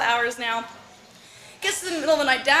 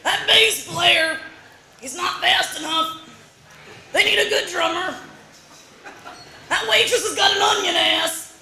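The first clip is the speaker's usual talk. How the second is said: louder, higher rasping heckle; loud grumbling uncle; 6 semitones higher, 5 dB louder than usual. Retold or imitated. Uncle loudly heckling the band over the music. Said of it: "talking loudly enough".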